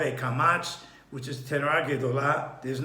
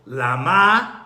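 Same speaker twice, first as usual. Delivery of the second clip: Lama louder, MA stressed and left hanging; stress on last syllable; 8 dB louder than usual. Shown as a demonstration. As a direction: land hard on the MA of Lama. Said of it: "the emphasis on the last syllable".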